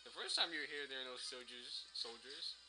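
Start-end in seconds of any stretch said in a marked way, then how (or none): none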